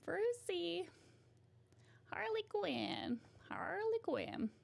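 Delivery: high, drawn-out mock Brooklyn voice; failed Brooklyn accent sliding into Texan; Harley Quinn. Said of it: "Why is she Texas now?".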